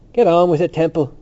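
crying out. A narrator's reading. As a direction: call out to hurry him along. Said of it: "the stout student cried from the steps".

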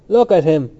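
loud, emphatic derision; scornful. A narrator's reading. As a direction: call others to witness, scornfully. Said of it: "he said with scorn to the others".

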